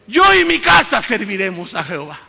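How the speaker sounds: loud triumphant scripture; proclaimed verse. Real, not acted. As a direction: proclaim it to the whole church.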